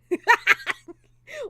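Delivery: big loud laugh bursting out; loud burst of laughter. Real, not acted. Streamer bursting into a loud laugh at chat. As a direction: laugh out loud at her own joke.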